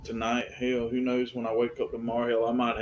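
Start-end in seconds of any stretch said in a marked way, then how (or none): none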